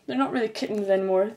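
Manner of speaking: ordinary talk, nothing marked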